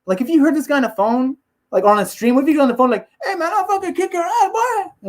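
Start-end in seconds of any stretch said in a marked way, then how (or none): 3.2-5.1 falsetto mock threat; Yoel Romero. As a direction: threaten in a tiny, very high imitated voice. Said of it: "with your voice".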